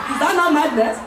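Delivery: ordinary delivery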